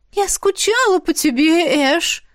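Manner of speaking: put-on high, slow, sugary girl's voice; coy confession. A narrator's reading. Isabel tells Ash she missed him, high and sugary. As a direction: confess it sweetly, hands to chest. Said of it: "призналась Изабель".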